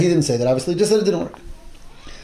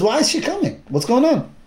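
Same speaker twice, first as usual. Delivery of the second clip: higher, puzzled voice of the prophet; alarmed question; 8 semitones higher than usual. Acted. Elisha asks his attendant, alarmed and puzzled. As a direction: sound startled as she comes into view.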